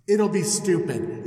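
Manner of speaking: ordinary delivery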